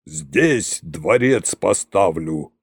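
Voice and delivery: низко, медленно, торжественно; провозгласи царскую волю, растягивая слова; властный Кощей. A narrator's reading. Koschei proclaims palace site. (низкий торжественный голос царя)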